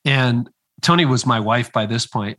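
usual speaking voice

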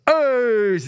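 high, loud exclaimed AIDS; mock Team America shout; stadium shout. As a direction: call it out like to a stadium crowd. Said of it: "and he goes".

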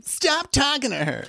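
high, slow, wimpy mock order; put-on unmanly protest; unmanly guy. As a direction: give a feeble order in a high, drawn-out voice. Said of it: "he's not the manliest guy".

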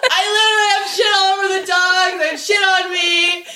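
high, half-shouted through laughter; panicked laughing outcry. Real, not acted.

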